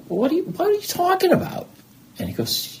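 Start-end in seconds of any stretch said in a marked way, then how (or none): 0.1-1.7 high-pitched retold disbelief; incredulous. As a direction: balk at the suggestion, pitched way up. Retold incredulous reply to friend's suggestion.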